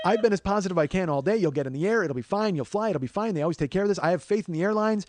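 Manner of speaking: loud, high, rattled-off insistence; hammed-up frustrated optimism; exasperated reassurance. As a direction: insist loudly that all is well. Exasperated pep talk to upset partner.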